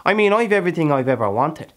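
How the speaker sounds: loud, high, impassioned boast; fierce boasting. Acted. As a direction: proclaim your hard-won riches at full voice. Character boasts heatedly of his land.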